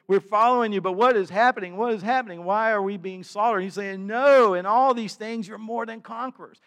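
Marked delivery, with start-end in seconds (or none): 0.1-3.6 loud, high anguished plea; demanding answers, voice raised high; crying out. Acted. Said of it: "they're crying out". 4.1-6.6 ringing rebuttal to the despairing; emphatic answer. Acted. Preacher voices the emphatic answer.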